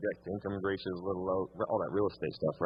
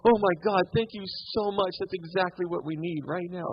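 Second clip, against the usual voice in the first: gushing grateful client; 9 semitones higher than usual. Retold or imitated. Client thanking the agent for the home list, gushing with relief. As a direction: play the relieved client overjoyed at the help. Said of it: "guess what they said".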